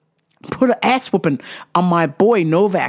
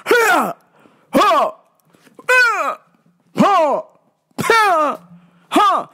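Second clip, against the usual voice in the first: high, sharp grunts of effort; mock tennis-player grunting; imitated tennis grunts; 9 semitones higher, much slower than usual. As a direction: grunt with each racket hit. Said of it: "guys going".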